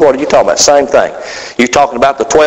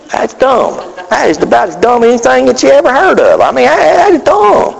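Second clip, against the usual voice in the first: incredulous; 7 semitones higher than usual. Real, not acted. Preacher mocking four wives.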